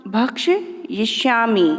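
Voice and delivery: भक्ष्यिष्यामि slowly, broken in two; pronunciation demo. Shown as a demonstration. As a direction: model भक्ष्यिष्यामि in slow, separate parts. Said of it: "मैं आप लोगों को सिखाती हूँ". (भक्ष्यिष्यामि धीरे, तोड़कर उच्चारण)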